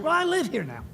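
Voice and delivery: the New Yorker settling the matter; New York accent. Retold or imitated. New Yorker explaining he moved to Oklahoma, imitated New York accent. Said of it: "one of these great New York accents".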